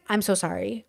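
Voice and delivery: soft, low apology; hushed re-enacted sorry; sheepish apology. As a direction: apologize under your breath. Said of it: "And I was like".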